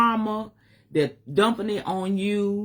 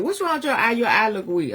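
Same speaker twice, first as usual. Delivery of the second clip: mama's voice; 5 semitones higher than usual. Retold or imitated. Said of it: "Mama said".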